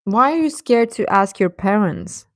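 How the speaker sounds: ordinary delivery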